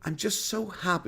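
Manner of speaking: a hint of a syrupy fake-nice tone; mock sentimental voice. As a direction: imitate a put-on sincere streamer. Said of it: "When he's doing his, that voice".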